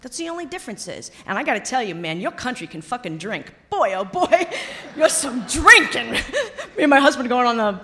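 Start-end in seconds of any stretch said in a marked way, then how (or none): 3.7-7.8 laughing through the words, louder; real amused laughter while talking. Real, not acted. Laughing about the local drinking.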